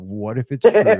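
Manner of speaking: half-laughed wondering; laughing hopeful wonder. Retold or imitated. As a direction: giggle through the thought. Laughing retold thought.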